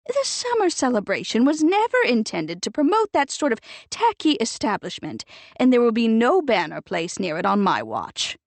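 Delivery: lightly voiced society lady, scolding; a hint of a prim committee lady tutting; prim disapproving matron. Edith reproaching Nick about the family firm, prim and disapproving.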